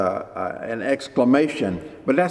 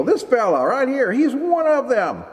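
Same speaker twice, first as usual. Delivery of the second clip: much higher, pointing out Peter to the crowd; accusing servant girl; 11 semitones higher than usual. Retold or imitated. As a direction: announce to everyone that he's one of them.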